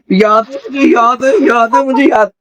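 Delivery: high, repetitive mimicry of her excitement; mocking excited student. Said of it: "एकदम जान पे मार के बताती है".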